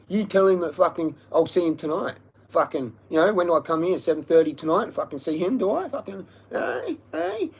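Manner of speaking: high, goading challenge; retold threatening demand. Speaker sends a threat through the jogger, goading. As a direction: push for an answer, pitch climbing to the last hey.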